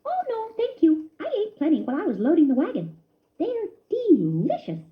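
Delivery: high friendly mouse voice; a cheerful cousin offering a gift; lightly voiced happy mouse; Ced the cousin. A narrator's reading.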